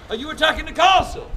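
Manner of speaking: lightly shouted challenge from below; mock shouting down. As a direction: call down as an officious jobsworth. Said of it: "And he shouts down".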